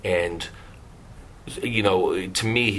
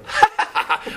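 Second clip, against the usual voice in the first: laugh. Retold or imitated. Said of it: "she starts laughing".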